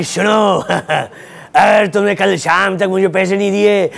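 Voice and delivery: ordinary delivery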